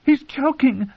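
high, breathless alarm; gasped alarm. Acted. Margaery gasps as the king chokes, high. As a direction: gasp it in fright. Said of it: "Queen Margaery gasped".

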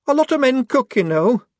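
high, heated defensive reply; hot retort. A narrator's reading. Shufti defending her cover to Polly, heated. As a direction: snap back, stung. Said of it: "said Shufti hotly".

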